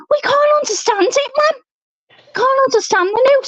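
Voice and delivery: very high mock-exasperated protest. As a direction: plead with mum that it's hopeless. Acting out children protesting to mum.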